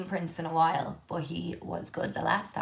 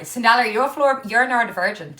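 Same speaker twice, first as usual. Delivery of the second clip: calling out; 4 semitones higher, 9 dB louder, faster than usual. Real, not acted. Calling out to a viewer.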